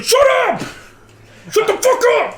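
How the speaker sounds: high-pitched furious yelling; angry yell. Retold or imitated. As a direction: scream it at them, enraged. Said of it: "one kid that got so fucking mad".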